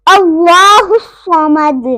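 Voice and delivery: loud, slow अल्लाहु समद with rounded heavy vowels; full-mouth recitation. Shown as a demonstration. Teacher models the second verse, heavy recitation. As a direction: draw out अल्लाहु, bounce the D of समद. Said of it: "यहाँ पर पुर पढ़ा जाएगा".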